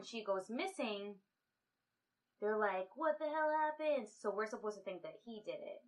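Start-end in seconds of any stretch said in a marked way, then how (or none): 3.0-4.1 high-pitched mock bewilderment; retold shock. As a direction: act out their shock, pitched up. Retold housemates shocked at disappearance.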